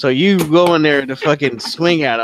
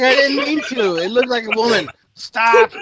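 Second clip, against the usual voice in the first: much higher, panicky begging voice; pleading captive; 11 semitones higher, 4 dB louder than usual. Acted. GM's captured rider begs the archer, high and panicked. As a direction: beg for your life in a high squeak. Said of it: "He's like".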